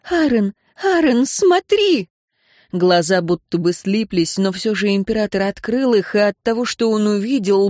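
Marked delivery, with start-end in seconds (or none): 0.1-2.1 high, climbing excited cry; urgent calling. A narrator's reading. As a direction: call out urgently, higher on each word. Энн urgently calls Аарон to look.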